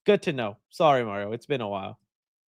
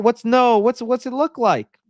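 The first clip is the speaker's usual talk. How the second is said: ordinary delivery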